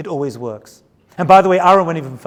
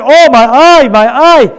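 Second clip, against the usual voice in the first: higher, much louder hammed-up wail of pain; faked eye pain; 6 semitones higher, 10 dB louder, slower than usual. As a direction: fake an injury to draw her in. [name] fakes an eye injury.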